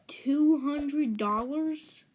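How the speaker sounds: slow, drawn-out incredulous question; stretched-out, appalled repeat; shocked disbelief. Retold or imitated. Babysitter's shocked repeat of price, retold.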